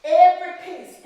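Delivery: high, loud snap of every piece; sharp repeat. Acted. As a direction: hammer home every piece.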